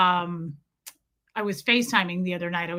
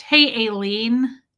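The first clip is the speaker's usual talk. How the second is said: normal talking voice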